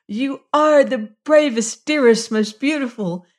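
high, loud gushing praise; shower the hippogriff with delighted praise; adoring cry. Acted. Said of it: "he cried".

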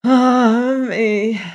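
drawn-out woeful exclamation; woeful sigh. A narrator's reading. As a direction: sigh it out in dismay. Old man lamenting bad news.

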